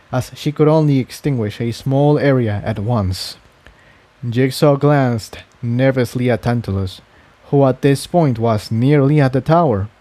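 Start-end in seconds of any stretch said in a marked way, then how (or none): none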